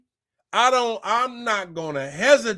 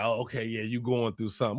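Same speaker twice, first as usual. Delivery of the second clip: much softer and lower, smug faked concern; mock fake sympathy; 8 semitones lower, 9 dB quieter, faster than usual. Imitating a gloating friend, quiet mock sympathy. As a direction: murmur it like pretend pity.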